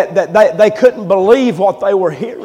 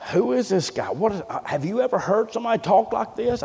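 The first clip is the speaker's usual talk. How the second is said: hushed, voiced imitation of whispering; retold whisper; 10 dB quieter, faster than usual. Doctors whispering to each other about the boy, hushed. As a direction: murmur it softly, as if behind a hand. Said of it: "they was whispering to each other".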